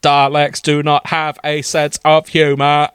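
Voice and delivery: robotic, even monotone; Dalek voice. Acted. Dalek corrects his joking friend, mechanical monotone. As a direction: state it as a humourless Dalek. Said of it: "his delicate scream".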